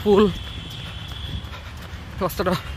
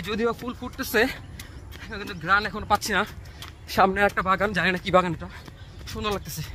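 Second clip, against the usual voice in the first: while running; 8 dB quieter than usual. Real, not acted. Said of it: "দৌড়াচ্ছি".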